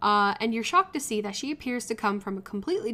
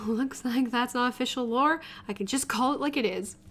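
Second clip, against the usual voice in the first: usual speaking voice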